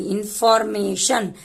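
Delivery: "इन्फॉर्मेशन" said slowly in separate syllables. Shown as a demonstration. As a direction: break "इन्फॉर्मेशन" into small, slow parts. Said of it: "मैं धीरे धीरे करके बताऊंगी".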